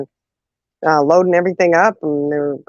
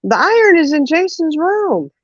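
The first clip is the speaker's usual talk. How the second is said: put-on kid reporting; 11 semitones higher than usual. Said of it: "And I said".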